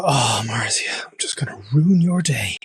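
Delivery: breathy, whispered mock scheming; mock-sinister whisper. Host pretends he woke up planning to upset the guest, mock-sinister whisper. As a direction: whisper it like a playful villain's plan.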